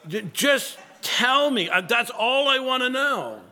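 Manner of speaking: a fed-up home cook cutting off a rambling recipe blog; impatient cook. Retold or imitated. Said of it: "and you're like".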